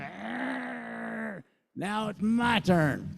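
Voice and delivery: high, slow, fuming growl of a mock-angry lawyer; steaming lawyer. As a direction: play the kicked lawyer, hammed-up and seething. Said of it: "the lawyer was steaming and going".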